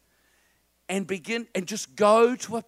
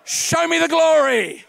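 loud, higher chant for the crowd; call and response; 5 semitones higher, 5 dB louder than usual. Shown as a demonstration. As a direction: lead the congregation to repeat it. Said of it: "Can you just say that with me".

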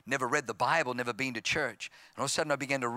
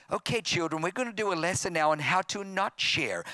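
higher-pitched pretend lesson voice; mock teacher; 5 semitones higher than usual. Parent pretending to teach children selfishness, mock teacher. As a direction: announce it like a teacher to small children.

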